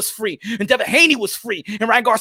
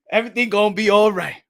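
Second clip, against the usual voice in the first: ordinary talk, nothing marked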